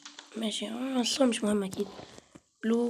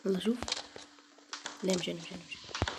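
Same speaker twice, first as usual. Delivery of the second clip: much softer, lower, almost breathless murmur; hushed hiding; 4 semitones lower, 10 dB quieter than usual. Real, not acted. Gamer hiding from the monster, hushed low murmur. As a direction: keep it hushed so the monster won't hear.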